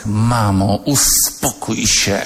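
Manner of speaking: put-on a drawn-out teenage hiss, slow; bored teen daughter hissing through her teeth, slowly. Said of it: "i ten syk".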